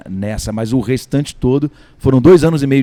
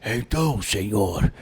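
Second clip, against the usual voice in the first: put-on old man voice; 3 semitones lower, slower than usual. Shown as a demonstration. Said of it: "você vê o velho fazendo".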